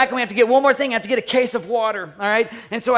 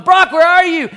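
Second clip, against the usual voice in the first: loud, high searching shout; calling out; 8 semitones higher, 9 dB louder than usual. Retold or imitated. Dad following his son's voice, shouting. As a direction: holler for someone out of sight.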